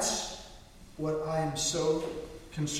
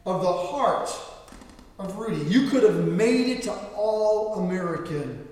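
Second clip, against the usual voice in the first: loud, higher contemptuous rebuke; coach dressing down; 5 semitones higher, 6 dB louder than usual. Retold or imitated. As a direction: coach sending a player to prep team. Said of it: "He literally says these words".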